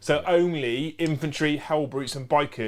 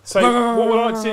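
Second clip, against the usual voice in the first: announcer voice; 8 semitones higher, 9 dB louder than usual. Real, not acted. Announcing the chat question aloud.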